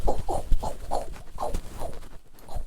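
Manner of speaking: voiceless puffed hitting noises; rapid mock scuffle sounds, trailing off; imitated blows. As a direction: act out a flurry of swipes with sharp puffs. Imitates mom coming at her.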